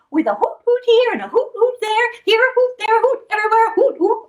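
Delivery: high, quick hoot hoot; high owl hoots. Acted. Owl hooting in song.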